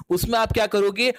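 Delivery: ordinary talk, nothing marked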